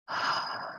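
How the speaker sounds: long, soft exhale; a soft, voiceless breath out; letting-go sigh. Shown as a demonstration. Teacher showing how to step back, letting-go sigh. Demonstrates stepping back and opening up.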